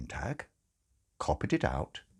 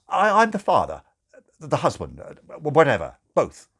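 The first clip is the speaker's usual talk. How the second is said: high, louder and stumbling; flustered father; 6 semitones higher, 7 dB louder than usual. Acted. New father flustered at the door, stammering loudly. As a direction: trip over your own words.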